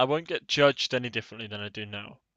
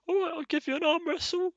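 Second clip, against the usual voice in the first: much higher, small squeaky voice. Pretend. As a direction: pipe it up like a tiny guy. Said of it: "be like".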